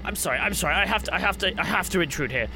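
normal talking voice

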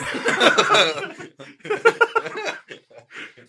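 big burst of high laughter; loud laugh. Real, not acted. Laughing at saxophone joke.

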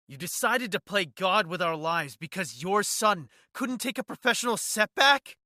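loud, rising furious accusation. A narrator's reading. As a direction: build to an outraged yell. Angry confrontation with villain.

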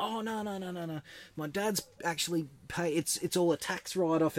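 retold mate talking his way out of it; defensive protest. Housemate fends off questions about buying the car, defensive.

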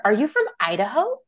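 mock curious surprise. Imagined stranger trying another home state, mock curious surprise. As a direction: lightly act a stranger guessing.

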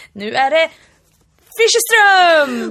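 loud, high, drawn-out hype shout; show-host announcement. Pretend. Co-host mocks a solo podcast opening, hammed-up announcer shout. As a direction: belt out the name like an arena announcer. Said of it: "då skulle det bli så här".

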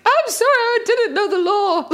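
high-pitched retold apology; panicked mock plea. Telling guards they didn't know, mock panicked plea. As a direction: plead innocence, hammed-up.

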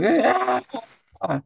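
sharp high yelp of pain; pained high shout; high pained cry. Acted. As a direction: cry out as the curse hits. Rahadin clutching head.

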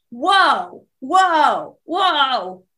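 lightly hammed-up whoas; mock startled whoas. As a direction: play the back jolting in surprise. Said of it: "spasmed my back to be like".

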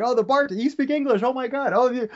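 high-pitched, re-enacted wide-eyed excitement; excited amazement. Telling of marvelling that locals speak English, high excited voice.

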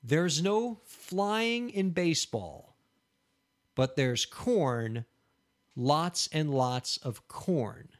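very slow, careful repeat; slow echo of the clue. Shown as a demonstration. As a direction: say it back word by word to check it. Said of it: "Did I get that right?".

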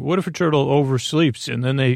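normal talking voice

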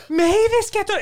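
very high, loud mock outburst; imitated losing it. Cyclist losing it at the driver, high mock scream. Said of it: "she loses it".

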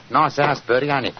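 a hint of cheery Cockney; lightly Cockney gas man; Cockney accent. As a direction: chat like a Cockney workman. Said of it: "My Cockney accent doesn't compare with yours".

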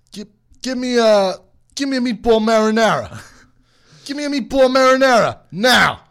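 hammed-up mafia boss. Mafia boss ordering at Subway, loud mock menace. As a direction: bark the order like a bad gangster. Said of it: "Italian mafia boss".

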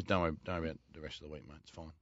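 soft, fast, low dismissive mumble; coach brushing off. Retold or imitated. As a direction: wave the player off gently. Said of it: "So he goes".

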